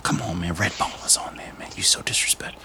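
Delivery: hushed whispered aside; whispering. Acted. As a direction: whisper it like a private jab. Host mutters aside at co-host.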